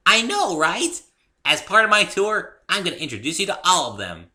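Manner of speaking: very high, loud, bouncy voice; giddy pony. A narrator's reading. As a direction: promise the tour with glee. Pinkie plans the tour.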